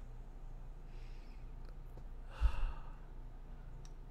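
long, heavy unvoiced sigh; shaky sigh. Real, not acted. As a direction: exhale the grief slowly. Grieving after breaking down.

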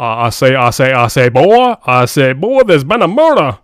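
loud, blustering Southern cartoon rooster; Foghorn Leghorn. Host mocks an actor's bad Southern accent, Foghorn Leghorn bluster. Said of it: "It's like Foghorn Leghorn from Looney Tunes".

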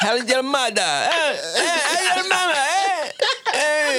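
high, loud hammed-up Italian; laughing cartoon Italian; fake Italian accent. Mock Italian banter, laughing.

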